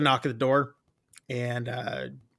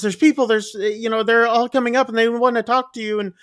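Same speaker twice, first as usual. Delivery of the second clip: high-pitched frightened rush; imitating a scared girl blurting it out; 9 semitones higher, 4 dB louder than usual. Wen telling her dads about armed strangers, high and frightened. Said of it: "is getting scared".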